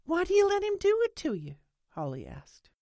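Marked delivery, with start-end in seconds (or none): 0.0-1.6 much higher, louder younger-woman character; Holly's earnest questioning. A narrator's reading. Holly asking Lena why she allows it, urgent. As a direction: press her in a high, urgent voice.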